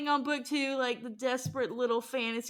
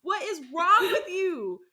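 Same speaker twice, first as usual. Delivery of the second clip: higher and louder, outraged; mock outraged; 7 semitones higher, 4 dB louder than usual. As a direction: rant at them in disbelief. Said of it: "She's like".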